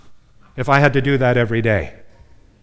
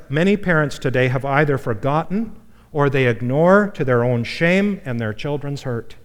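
ordinary delivery

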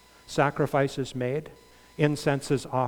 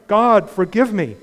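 louder pleading cry; crying prayer; 5 semitones higher, 8 dB louder than usual. Acted. Preacher voicing the tax collector's cry for forgiveness. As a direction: plead with God out loud.

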